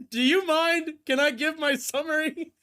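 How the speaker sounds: loud, high mock indignation; hammed-up protest at being cut off; mock outrage.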